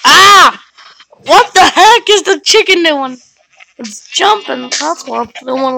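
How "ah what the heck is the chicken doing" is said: high, loud shocked outburst; startled shout. Real, not acted. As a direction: yell in surprise. Sudden chicken surprise.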